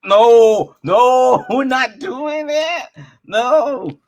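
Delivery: high, loud no breaking into laughter; slow, laughing no no no; laughing refusal. Retold or imitated. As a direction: shut it down while cracking up.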